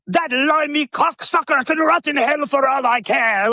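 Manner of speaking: high, bitter mock rant; hammed-up furious Lugosi rant; angry rant. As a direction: spit it out in contempt. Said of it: "explodes and goes off on this".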